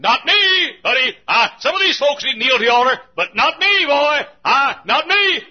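high loud cocky fast shout; boastful sneer. Acted. Proud backslider boasting he needs no altar, loud. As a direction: brag like a smug sinner.

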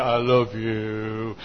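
very low, slow, soft croon; mock lovestruck man. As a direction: imitate a besotted groom deep and drawn out. Mimics honeymooner, man's part.

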